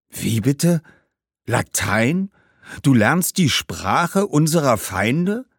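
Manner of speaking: mildly scandalised questioning, drawn out at the end; a touch of disbelief; appalled ghost. Acted. Ghost appalled by Latin lessons. (leicht empörter, ungläubiger Geist)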